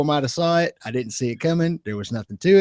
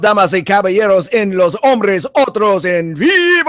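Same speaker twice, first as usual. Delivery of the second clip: lucha libre announcer; 9 semitones higher, 8 dB louder, slower than usual. Acted. Show opening announcer shout.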